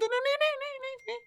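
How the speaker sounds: squeaky villain glee; Joker-like voice. Pretend. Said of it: "kayak Joker lah gitu".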